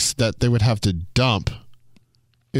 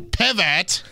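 high-pitched mock yell from Friends; imitated sitcom yell, pitched way up; Ross yelling; 7 semitones higher than usual. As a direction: yell it like Ross hauling a couch. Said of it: "with the couch yelling".

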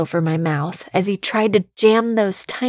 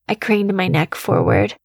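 usual speaking voice

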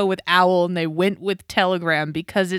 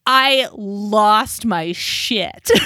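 ordinary talk

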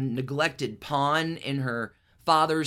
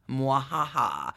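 mad scientist; 3 semitones lower, slower than usual. Pretend. Villainous sign-off after mischievous deeds.

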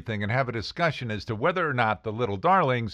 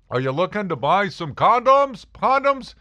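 much louder, higher mocking announcement; broadcasting pharmacist; 13 semitones higher, 8 dB louder than usual. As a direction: announce it to the whole store. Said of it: "he didn't broadcast it like that".

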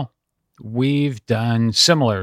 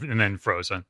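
normal talking voice

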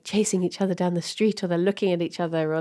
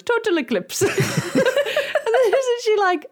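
laughing through the words; slower than usual. Retold or imitated. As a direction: giggle through the punchline. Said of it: "stuffs it in her mouth and goes".

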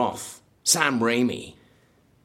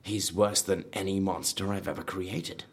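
ordinary talk, nothing marked

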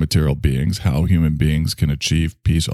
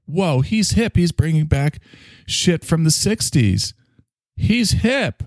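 put-on much higher, awestruck kid voice; mock impressed kids; 9 semitones higher, slower than usual. Kids amazed at their hip dad, high mock awe. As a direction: imitate kids marvelling at dad. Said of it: "And they were like".

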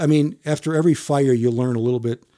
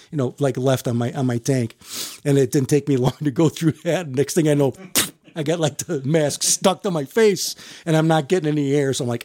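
normal talking voice

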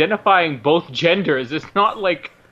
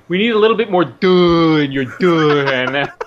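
a hint of a director's voice, louder; mock director note; 3 dB louder than usual. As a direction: direct the actress half-seriously. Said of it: "Sam Mendes off camera".

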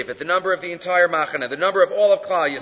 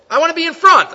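eager plea; 10 semitones higher, 8 dB louder, faster than usual. Pretend. Voicing an eager Israelite.

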